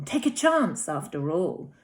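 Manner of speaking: ordinary delivery, nothing marked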